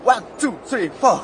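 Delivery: count-in building into loud whooping; imitated pre-show hype. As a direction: imitate a performer psyching up backstage. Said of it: "出番ってなった瞬間に".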